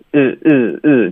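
short, lax "ih" sound, said as separate bursts for 日; slack-tongued short vowel on 日; lax tongue. Shown as a demonstration. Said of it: "就是放松了舌头". (舌头放松的短促 ɪ 音，即 日)

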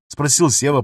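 ordinary delivery

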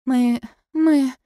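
Lucy stammering, unsure of herself. A narrator's reading. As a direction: stumble over the first words nervously. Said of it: "заикается Люси".

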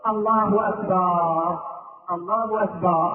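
slow takbir ending in long "akbaar"; added alef in أكبر. Shown as a demonstration. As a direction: stretch the vowel after the ب in "أكبر". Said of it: "إدخال ألف بعد الباء في كلمة أكبر". (مد الباء في أكبر: أكبار)

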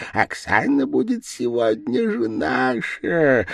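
devil's insinuating stage whisper, partly voiced; sly, voiced mock whisper. A narrator's reading. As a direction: tempt him as if into his ear. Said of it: "шепнул он".

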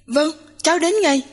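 high, hurried-sounding agreement; mildly alarmed yes on the phone; urgent assent. A narrator's reading.

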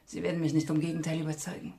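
usual speaking voice

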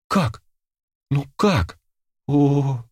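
high, helpless bewilderment; shocked. A narrator's reading. Girl finding her hands are different, shocked. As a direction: wail the question in disbelief.

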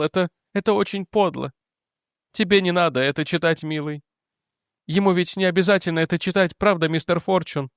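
mother pleading with her angry son; weak remorseful mother. Acted. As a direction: beg him gently to forget. Mother voiced higher.

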